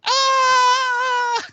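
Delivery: very high, loud mock shriek; girly scream. Mocking Giles fleeing the boarding house, a shrill mock scream. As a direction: scream like a panicked girl. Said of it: "he's running like a girl".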